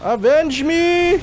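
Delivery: slow, high, loud battle cry; hammed-up heroic last words; dramatic dying cry.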